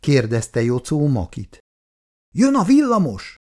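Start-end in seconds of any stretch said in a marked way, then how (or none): 2.3-3.3 high, loud lookout's cry; warning shout. Acted. Lookout boy warns the gang the tram is coming, high loud shout. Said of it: "figyelmeztette őket Kókusz".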